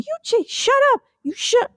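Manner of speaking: the first shoe fights for its turn; first shoe, bickering. Pretend. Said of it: "I'm a shoe".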